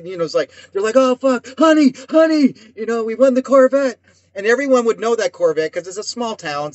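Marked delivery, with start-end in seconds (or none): 1.0-4.0 higher-pitched excited calling out; excited winner. Retold or imitated. Prize winner telling honey about the Corvette, excited. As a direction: call excitedly for your partner with big news.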